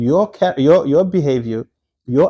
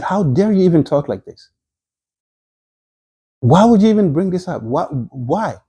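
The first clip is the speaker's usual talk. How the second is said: higher-pitched indignant questioning; retold rebuke; 5 semitones higher than usual. Husband scolds his wife over the rumor, mildly rebuking. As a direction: take her to task, retelling a husband's reproach. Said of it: "He's rebuking his wife".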